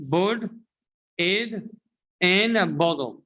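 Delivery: put, it, in a bottle in pieces, t's as d; broken-down American flaps. Shown as a demonstration. Teacher breaking it down for learners, separate d-sounded pieces. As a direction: say pud, id, in a boddle piece by piece. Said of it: "pay attention".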